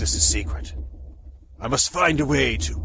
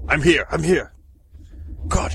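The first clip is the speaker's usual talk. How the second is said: usual speaking voice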